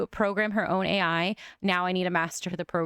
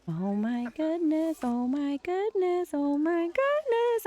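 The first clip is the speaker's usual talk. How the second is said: slow, rising from high to very high; gasping awe climbing higher each time; rising excitement; 9 semitones higher, slower than usual. Real, not acted. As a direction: build the thrill with each repeat. Robot coming to life.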